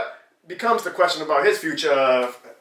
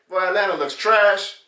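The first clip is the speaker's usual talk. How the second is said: usual speaking voice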